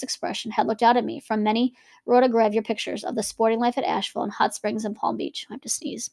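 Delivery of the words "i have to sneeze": breathy whisper, off the reading; whispered aside. Real, not acted. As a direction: whisper it to the listeners, half to yourself. Aside during reading, about to sneeze.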